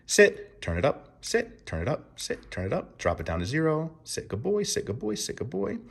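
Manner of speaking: calm cue, then soft praise; command and praise demo. Shown as a demonstration. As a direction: run the drill for a dog. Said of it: "let's do that again".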